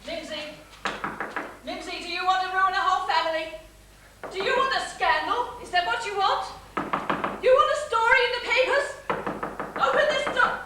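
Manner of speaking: loud, fast pleading shout; shouting through the door. Acted. Mother shouting at her locked-in daughter, loud and pleading. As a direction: bang on the door and plead loudly.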